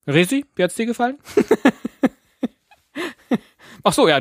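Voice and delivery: playful high voice. Pretend. Asks the dog/child playfully.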